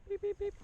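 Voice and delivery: high-pitched electronic beeps; high beeping. Pretend. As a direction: imitate an alarm beeping. Mimics a beeping alarm.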